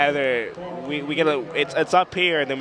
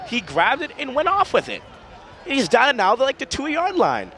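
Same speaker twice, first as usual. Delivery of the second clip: excited call; 10 semitones higher than usual. Real, not acted. Fumble return, colleague excited.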